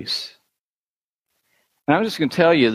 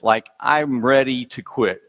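ordinary talk, nothing marked

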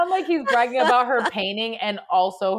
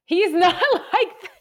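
high, louder, words breaking into laughter; laughing; 12 semitones higher, 5 dB louder, slower than usual. Real, not acted. Teasing friend about the dad voice, laughing through it. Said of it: "laughing while teasing the voice".

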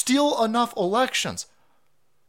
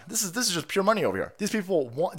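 normal talking voice

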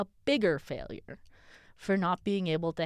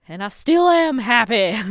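loud and higher, defensive; hammed-up angry old man yell; mock shouting old pastor; 5 semitones higher, 13 dB louder than usual. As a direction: bellow it at the doubter. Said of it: "I don't know why you're shouting at me".

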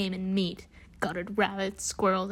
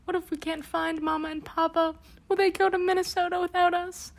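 tearful; 9 semitones higher than usual. Acted. Lost girl weeping.

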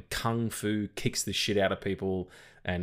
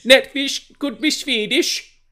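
much higher, slow, loud mock Swedish Netflix voice; mock Swedish accent; 11 dB louder, slower than usual. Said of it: "That wasn't a Swedish accent".

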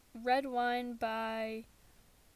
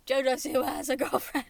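excited =23; 10 dB louder than usual. Real, not acted. Repeats news excitedly.

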